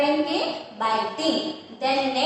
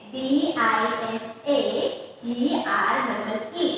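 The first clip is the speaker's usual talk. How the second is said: slow spelling; much slower than usual. Shown as a demonstration. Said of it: "next है".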